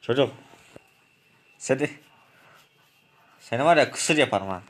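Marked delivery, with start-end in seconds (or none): none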